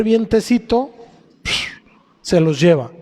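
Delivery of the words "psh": short imitated "psh" of a gust; quick puff of wind; whooshing wind sound. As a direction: blow it away with a quick psh. Imitates wind carrying tumbleweeds off.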